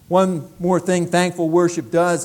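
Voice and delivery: ordinary delivery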